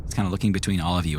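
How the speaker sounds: normal talking voice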